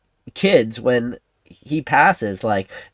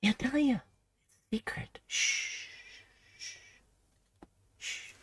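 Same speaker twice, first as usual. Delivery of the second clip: secretive hush; 11 semitones higher, 15 dB quieter, slower than usual. Pretend. Streamer teasing chat with a secret, whispered shush. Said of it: "it's a secret because I can't tell you".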